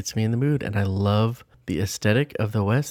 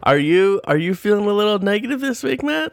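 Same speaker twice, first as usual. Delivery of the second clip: teasing; 11 semitones higher, 4 dB louder than usual. Real, not acted. Teasing cohost about negativity.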